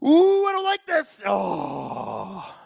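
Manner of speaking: very high, drawn-out anxious wail; real alarm. Real, not acted. Fan watches danger near the goal, high alarmed wail. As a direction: start high and slide down into a long oh.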